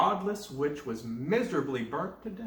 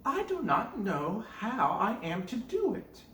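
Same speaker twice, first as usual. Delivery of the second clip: lightly voiced girl; 7 semitones higher than usual. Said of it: "Gretel said".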